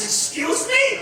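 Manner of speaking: high, loud indignant question; shocked, high-pitched retort; offended disbelief. Retold or imitated. Offended waitress answers the insult.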